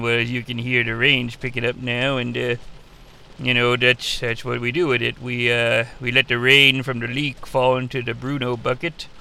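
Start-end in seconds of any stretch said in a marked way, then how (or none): none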